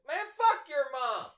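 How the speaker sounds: very high, drawn-out loud heckle; imitated yelled retort. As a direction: holler it as the mouthy coworker, stretched out. Coworker's retort, acted again.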